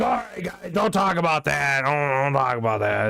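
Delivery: hammed-up defensive outburst that fades; mock defensive protest. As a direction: imitate someone flustered, shutting the subject down. Imitating friend defensively shutting down talk.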